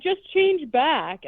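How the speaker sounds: high, slow mock pleading; mock panicked plea. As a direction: beg in drawn-out, hammed-up distress. Voicing Preston begging the gargoyle.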